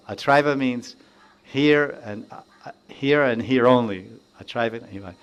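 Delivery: usual speaking voice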